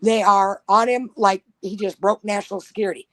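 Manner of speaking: ordinary delivery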